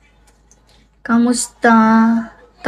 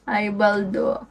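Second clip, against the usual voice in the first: normal talking voice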